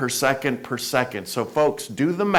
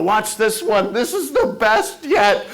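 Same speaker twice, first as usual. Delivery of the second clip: show-off boast; 8 semitones higher than usual. Retold or imitated. Showing off to buddies.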